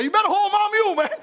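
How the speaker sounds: high, loud, bursting out; overcome with joy. Retold or imitated. Farmer Brown to the deacons as the spirit hits, ecstatic cry. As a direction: let the spirit take you mid-sentence.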